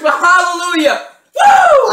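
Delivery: loud, high celebratory shout; excited shout. Real, not acted. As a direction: cheer in triumph. Celebrating lucky bean.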